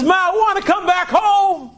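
high-pitched, heartfelt appeal to mother; acted son begging to return; emotional plea. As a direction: plead with pitch held high. Retold son pleads to come home.

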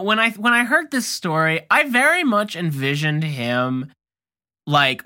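ordinary delivery

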